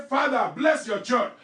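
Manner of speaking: steady declaring prayer lines for others to repeat; leading a prayer. Acted. Pastor leads the church in prayer, declaring. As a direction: declare each line so they can follow.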